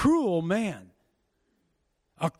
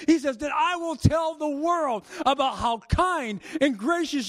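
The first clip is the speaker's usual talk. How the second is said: preaching; 7 semitones higher than usual. Acted. Preacher voicing King David's plea, high and pleading. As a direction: plead it to the heavens.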